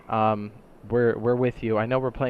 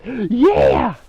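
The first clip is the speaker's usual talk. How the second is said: excited; 12 semitones higher, 12 dB louder than usual. Real, not acted. Gasps then excited yeah.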